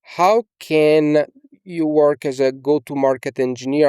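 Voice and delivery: ordinary talk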